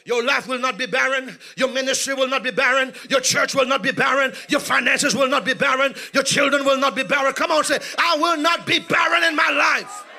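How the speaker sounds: loud shouted preaching, building to a climax; preaching at full force. Acted. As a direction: pound out every line to the congregation.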